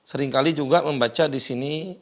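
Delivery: ordinary delivery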